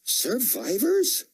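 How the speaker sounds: high, slow, disbelieving; astonished question. A narrator's reading. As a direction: drag the word out in awe. Soldier wonders aloud.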